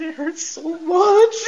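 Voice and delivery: mock pained whimper, high and drawn out; crying and moaning. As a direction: moan it like a man in mock agony. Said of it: "sounded like Paul Bearer with all the crying and moaning".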